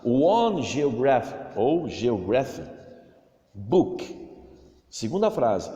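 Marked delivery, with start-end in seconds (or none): none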